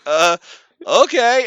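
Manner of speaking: high, loud, bewildered; stunned okay. Retold or imitated. Driver answers the worker, high and dumbfounded.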